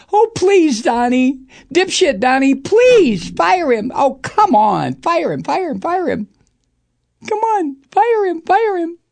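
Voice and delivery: squealing high, pleading mockery; high sing-song goading to fire him; high mocking taunt. Real, not acted. Taunting Trump to fire Mueller.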